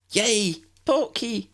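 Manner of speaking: high, bright squeal of delight; chirpy excitement. A narrator's reading. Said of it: "Lydia chirruped".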